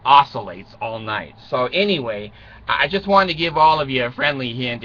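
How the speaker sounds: usual speaking voice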